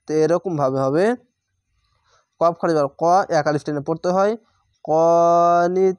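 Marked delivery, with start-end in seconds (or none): 4.8-6.0 কনিতিন held very long and loud on the last vowel; three-alif stretch. Shown as a demonstration. Teacher showing the long stop on কনিতিন. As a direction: stretch the ending three alifs. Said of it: "তিনটি অক্ষর তিন আলিফ টেনে পড়বেন".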